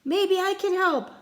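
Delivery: high, loud offer; shout over wind. Acted. Boy offers help in storm, loud shout. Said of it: "I shouted over the wind".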